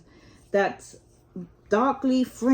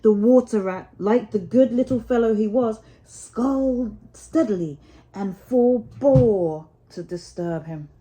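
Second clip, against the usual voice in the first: ordinary delivery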